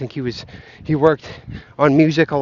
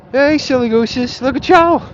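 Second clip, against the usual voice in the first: greeting the geese; 8 semitones higher, 3 dB louder than usual. Real, not acted. Calling out to geese.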